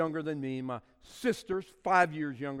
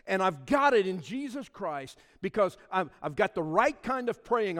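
loud, ringing sermon delivery; fervent preacher declaring his faith; preaching; 3 semitones higher, 7 dB louder than usual. Acted. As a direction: proclaim it to the congregation with full force. Preaching at full force.

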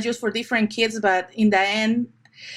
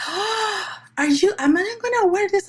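put-on much higher, quicker wide-eyed child voice; imitated amazed child; 10 semitones higher than usual. Photographer voices a girl offered angel wings, high amazement. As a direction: imitate a thrilled little girl, pitched right up. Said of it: "her eyes just bright out like".

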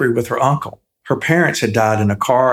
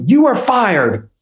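put-on higher and slower, a bold child's declaration; said loudly; 9 semitones higher, slower than usual. A narrator's reading. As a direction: announce it with sudden courage, slowly. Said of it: "he said loudly".

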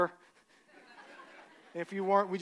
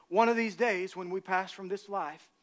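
ordinary delivery

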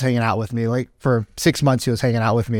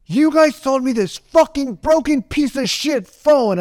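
angry tough guy; 10 semitones higher, slower than usual. Retold or imitated. Burly rancher storms into the phone store, angry. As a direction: act out a burly customer's furious complaint. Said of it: "he was just a tough guy".

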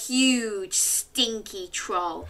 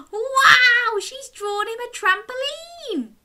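high, amazed and delighted; excited; 6 dB louder than usual. Real, not acted. Excited reaction to picture.

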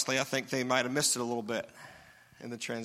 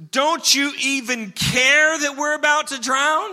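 high, loud, slow indignant yell; accusing shout; 10 semitones higher, 9 dB louder, slower than usual. Retold or imitated. Disciples yelling at Jesus in the storm, loud accusing shout. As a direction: hurl it at him like a frightened accusation. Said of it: "shouting, yelling at Jesus".

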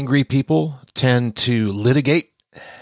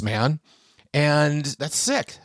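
ordinary delivery

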